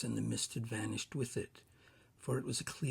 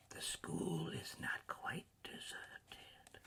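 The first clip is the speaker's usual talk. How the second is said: slow, soft, whispery ghost; hushed spirit; 6 semitones higher, 7 dB quieter, slower than usual. A narrator's reading. Ghost revealing the school to Scrooge, slow whisper. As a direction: draw it out in an airy whisper.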